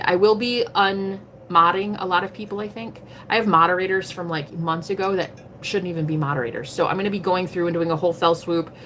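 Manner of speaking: ordinary delivery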